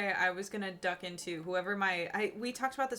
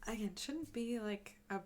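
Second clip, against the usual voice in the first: airy voice; 7 dB quieter than usual. Real, not acted. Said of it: "I keep not talking in a nice little airy voice".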